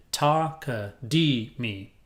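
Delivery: Ta-ka-dee-me stretched very slowly, each syllable apart; teacher breaking the word into its four syllables; slowly spelled out. Shown as a demonstration. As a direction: lay out Ta-ka-dee-me one syllable at a time, very slow. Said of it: "shoved a ka in the middle and put a me at the end".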